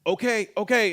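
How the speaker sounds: high, loud frightened okays; scared backing off. Retold or imitated. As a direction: back away from the gun, scared. Backing off from a gun.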